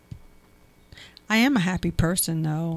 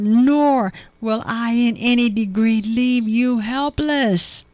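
fervent Bible reading; scripture reading building to fervor; 3 semitones higher, 5 dB louder than usual. Acted. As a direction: read the verse with mounting passion, louder as it climbs. Reads Hebrews aloud with rising fervor.

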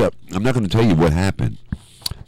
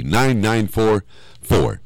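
ordinary talk

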